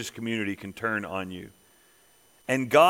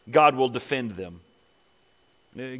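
usual speaking voice

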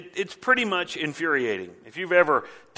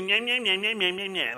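put-on high childish taunting gibberish; mocking nyah-nyah; 5 semitones higher than usual. Leaders drowning out the prophet, childish mocking. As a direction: jeer "nyah" in rapid repeats. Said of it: "in a mocking tone".

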